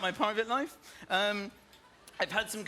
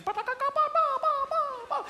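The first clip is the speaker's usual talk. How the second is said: high chirpy ba ba bird calls; imitated birdsong; 3 dB louder, much faster than usual. Comedian imitating birds welcoming his family. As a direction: chirp it like happy little birds. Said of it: "making lovely noises".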